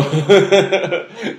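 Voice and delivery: high, loud burst of laughter; real giggling laugh; amused laugh. Real, not acted. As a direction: crack up at your own act. Laughing at own put-on attitude.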